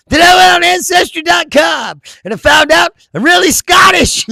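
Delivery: high, loud, overexcited announcement; mock commercial guy. Man in ancestry commercial revealing his discovery, mock excited shout. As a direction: shout it like a hammed-up testimonial. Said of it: "Then he's like".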